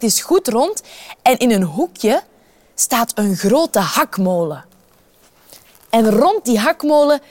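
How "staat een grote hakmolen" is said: usual speaking voice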